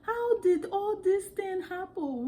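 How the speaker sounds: high, slow African accent mimicry; playful African accent imitation; copied African accent. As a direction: copy how African movie actors talk, in an African accent. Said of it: "We're just speaking like that".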